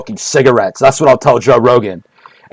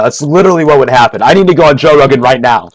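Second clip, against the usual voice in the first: ordinary delivery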